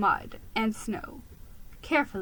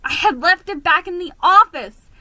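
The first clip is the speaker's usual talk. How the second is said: ordinary delivery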